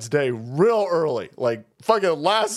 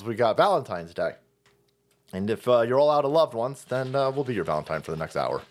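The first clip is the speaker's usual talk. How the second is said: ordinary delivery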